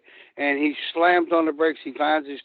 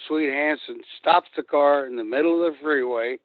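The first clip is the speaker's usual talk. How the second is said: ordinary talk, nothing marked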